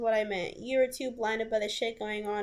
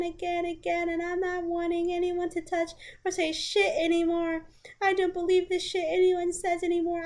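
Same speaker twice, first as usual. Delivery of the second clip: voicing the ex's message higher and pleading; distraught ex; 7 semitones higher than usual. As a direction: read it like a hurt, desperate partner. Reading ex's desperate message aloud.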